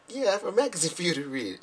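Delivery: high-pitched clueless reply; innocent insistence. Retold or imitated. Speaker answers the laughing sister, high and innocently insistent. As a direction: answer high, sure you said it right.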